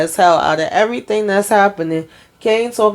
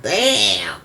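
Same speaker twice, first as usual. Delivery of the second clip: low, drawn-out disbelief; stunned reaction; 10 semitones lower than usual. Retold or imitated. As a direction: re-enact being floored, voice dropped deep. Her stunned reaction, retold.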